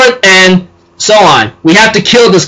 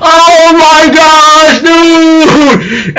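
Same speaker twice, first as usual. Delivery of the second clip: high, slow stunned cry; shocked exclaim; 9 semitones higher, much slower than usual. Retold or imitated. Viewer reacting to the villain's escape, high shocked cry. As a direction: gasp it out in disbelief. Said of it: "I'm like".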